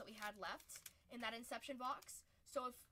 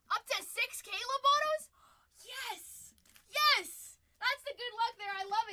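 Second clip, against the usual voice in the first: much louder and higher, shouting yes; excited cheer; 11 semitones higher, 16 dB louder, slower than usual. Real, not acted. Streamer cheers the viewer's big Caleb auto pull, excited shouting. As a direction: celebrate the pull at full volume. Big hit pulled live.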